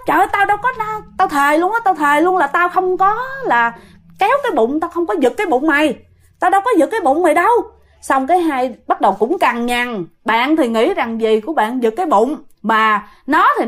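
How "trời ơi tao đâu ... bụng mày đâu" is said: high-pitched, insistent protest; indignant denial. Retold or imitated. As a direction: swear it wasn't you, pitched up. Aunt protesting innocence after fall. (cao giọng chối, thề thốt)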